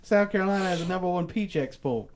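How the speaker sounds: put-on high, loud old South orator accent; theatrical high-pitched Southern accent; antebellum Southern accent. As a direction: hold forth in a hammed-up Southern accent. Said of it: "that's like an antebellum South accent".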